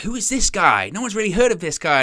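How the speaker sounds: mock-thrilled fan asking who this kid is; excited disbelief. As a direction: gush like a fan hearing about a hot new signing. Fans marvel at unknown signing.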